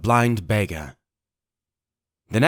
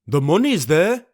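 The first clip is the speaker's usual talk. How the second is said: higher rough answer; pirate voice; 6 semitones higher, 4 dB louder than usual. Acted. Pirate answers Pugh from the window, rough. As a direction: call it back down.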